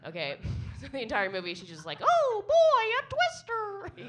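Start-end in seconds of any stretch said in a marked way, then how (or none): 2.0-4.0 very high, loud squeaky impression; squeaky high cartoon voice; Mickey Mouse voice. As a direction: play Helen Hunt like Mickey Mouse. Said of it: "sounds like Mickey Mouse".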